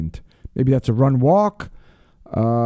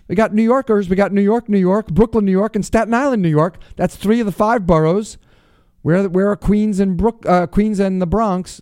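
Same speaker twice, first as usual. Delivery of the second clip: radio shout-outs; 7 semitones higher than usual. Acted. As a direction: call out each city with a showman's lift. Shout-outs to listener cities.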